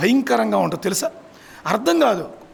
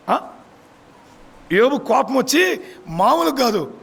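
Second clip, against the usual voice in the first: ordinary talk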